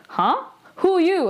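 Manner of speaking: high-pitched, mock bewildered question; puzzled double take, played for laughs; baffled reaction. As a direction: imitate someone thrown by a stranger walking in. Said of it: "her face was just like".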